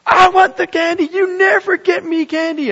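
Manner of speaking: put-on high, loud whiny child's yell; screaming kid. Kid screaming at mom in the store, high whiny yell. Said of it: "The kid starts screaming louder".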